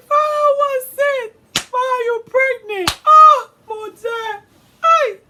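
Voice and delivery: hammed-up, very high crying shout; mock wailing. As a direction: bawl it slowly like a devastated mother. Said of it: "She crying".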